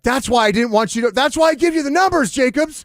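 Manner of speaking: high, loud furious shout; angry scolding. Retold or imitated. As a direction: chew out the board op. Theory scolding after the song.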